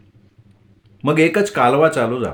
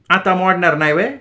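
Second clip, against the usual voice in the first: higher, pushed-up taunt; wife dares husband, voice pushed higher; voice strained up; 5 semitones higher than usual. Acted. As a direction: stretch the voice, daring him. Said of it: "तिने आणखीन आवाज ताणला".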